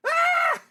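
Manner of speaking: shrill terrified scream; high panicked scream. Acted. Panics as leader approaches.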